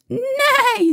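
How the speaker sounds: high whinnying neigh; whinny high like a horse; storybook horse noise, pitched high. A narrator's reading. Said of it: "said the horse".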